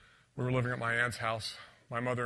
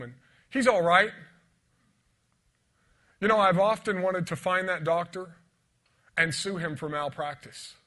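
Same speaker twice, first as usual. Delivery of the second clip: loud, higher, impassioned preaching; preaching at full force; 5 semitones higher, 8 dB louder than usual. Acted. Preacher telling the congregation he was dead, loud and fervent. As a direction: build to a fervent climax.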